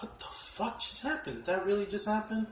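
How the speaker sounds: high-pitched retold shock, half played up; stunned disbelief. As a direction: replay the moment the ending floored you. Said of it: "I was just like".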